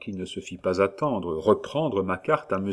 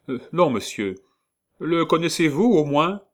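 guarded denial; 8 semitones higher, 5 dB louder than usual. Acted. Paul denies identity.

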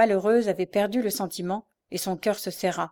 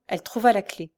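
ordinary delivery

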